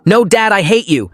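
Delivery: high, slow, shouted outburst; angry yell. Acted. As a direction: scream it at dad. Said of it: "I expected him to yell".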